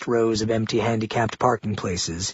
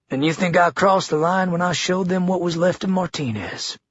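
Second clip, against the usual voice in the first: higher, challenging Southern man; governor voice; 5 semitones higher than usual. A narrator's reading. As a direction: throw it back as a challenge.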